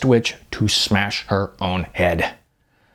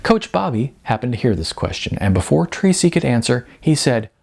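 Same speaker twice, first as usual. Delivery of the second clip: usual speaking voice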